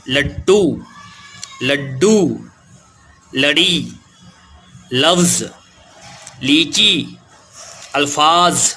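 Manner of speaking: put-on each word said out slowly and clearly for children; teacher pronouncing vocabulary slowly for young learners; clear word drill. Shown as a demonstration. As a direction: read out the word list one by one, like a teacher. Reading out a word list.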